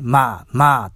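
まー called out loud and drawn out; loud, stretched まー, mimicking the rude callers; nickname minus chan. Shown as a demonstration. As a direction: punch out a long まー as a bare nickname. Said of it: "ちゃんを抜かしてね".

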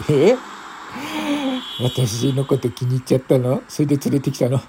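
put-on dead drunk woman. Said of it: "一人の泥酔した女性が".

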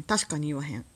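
normal talking voice

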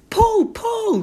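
very high, loud hooting; samba whoop. Retold or imitated. Mother jumping up to dance, loud high whoop. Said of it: "って言って".

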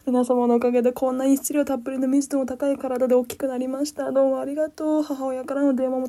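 loud, high, hammed-up speech voice; mock acceptance speech. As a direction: thank everyone like a star. Mock thank-you speech.

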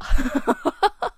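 high burst of laughter. Real, not acted. Host laughing at the disappointing app, high laugh. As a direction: break into a real high laugh. Laughing at his own punchline.

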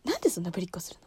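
quick hushed retelling; whispered mother's complaint. Retold or imitated. Quietly retelling her mother's complaint. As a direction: breathe it fast under her breath. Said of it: "喋り方が嫌いって言われる".

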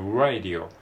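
radio begun with a tiny "u" sound; R with small u. Shown as a demonstration. Teacher explaining word-initial R, starting with a small u. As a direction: lead into radio as "u-radio". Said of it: "ちっちゃいうを、".

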